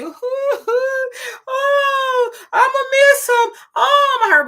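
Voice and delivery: hammed-up high wailing grief; mock wailing. Mocking people who make grief about themselves, hammed-up wailing. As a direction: wail it high and loud like a fake mourner. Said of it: "when you say".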